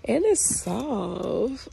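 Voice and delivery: loud, high and slow, melting over it; real gushing over something cute; gushing delight. Real, not acted. Gushing over tree hugger ornament.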